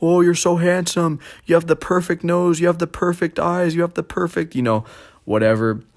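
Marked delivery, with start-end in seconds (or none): 0.0-4.6 high, gushing mock mom; doting mom. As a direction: gush over him like a proud mother. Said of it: "My mom, she'll always tell me".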